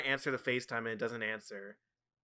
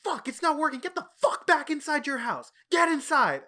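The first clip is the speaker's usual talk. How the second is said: high, loud, frantic yelling; retold scream, pitched way up; imitated screaming; 8 dB louder than usual. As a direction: act out an exasperated friend screaming orders. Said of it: "Wasim just starts screaming".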